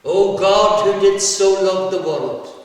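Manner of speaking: loud, high prayer intoned; priest's morning prayer. Acted. Priest starting morning prayers before the congregation, loud and intoned. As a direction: pray it out loudly to the whole chapel. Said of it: "he started morning prayers".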